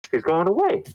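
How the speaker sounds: ordinary talk, nothing marked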